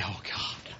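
low, barely voiced murmur; hushed prayer. Answering God's presence, hushed prayer. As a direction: breathe it out very softly.

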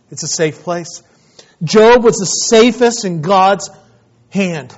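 loud, slow, fervent preaching. Acted. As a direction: preach it out with full force. Preaching at full force.

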